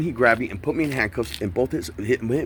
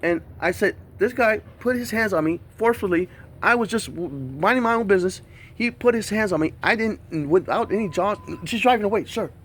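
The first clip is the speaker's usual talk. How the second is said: worked up retelling; 11 semitones higher than usual. Retold or imitated. Retold plea to police.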